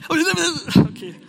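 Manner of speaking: fighting back a laugh, loud; barely holding in the giggles; suppressed laughter. Real, not acted. Speaker to his audience, struggling not to laugh. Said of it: "muss es gerade übertrieben unterdrücken".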